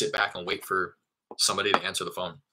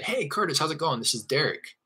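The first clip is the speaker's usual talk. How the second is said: high-pitched, like calling someone you already know; familiar greeting; 8 semitones higher than usual. Shown as a demonstration. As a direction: greet him as an old acquaintance, pitched up. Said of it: "to breed familiarity".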